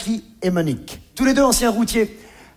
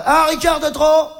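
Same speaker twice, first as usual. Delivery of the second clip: quoting Jackie; 8 semitones higher, 4 dB louder than usual. Retold or imitated. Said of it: "il disait aussi".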